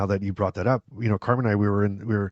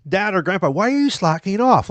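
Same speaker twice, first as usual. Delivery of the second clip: teasing relative; 9 semitones higher, 4 dB louder than usual. Acted. Playing relative nagging resting grandpa.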